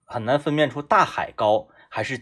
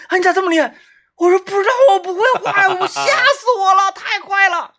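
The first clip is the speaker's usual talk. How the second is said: much higher, wide-eyed faked fright; mock scared innocence; 4 dB louder than usual. Faking novice after the run, mock-scared high voice. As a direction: play innocent and terrified, high.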